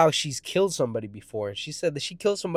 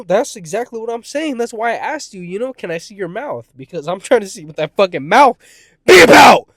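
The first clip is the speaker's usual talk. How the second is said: raised, higher voice exploding into a shout; building to a yell; 6 semitones higher, 13 dB louder than usual. Retold or imitated. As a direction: push higher and louder until the last words burst out. Retold plea building to a yell.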